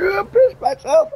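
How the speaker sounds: very high, squeaky laugh-cry; imitating wife crying with laughter. Wife gasping she wet herself, very high laugh-crying. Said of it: "she'd be like".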